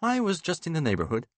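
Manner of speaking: quick, forced cheerful line; strained jollity. A narrator's reading. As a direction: fake breezy casualness. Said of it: "I say in a similar tone".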